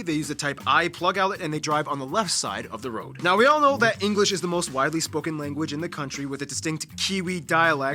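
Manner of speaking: usual speaking voice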